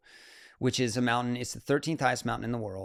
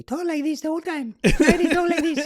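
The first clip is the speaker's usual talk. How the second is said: very high put-on Italian-accented voice; Simone Moro; 8 dB louder than usual. Climber showing his Italian partner's epic voice, very high imitation. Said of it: "Simone has this voice that is just epic".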